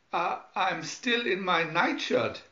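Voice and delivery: whiny complaint; complaining. Acted. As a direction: whine about it. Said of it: "complained Doctor Smith".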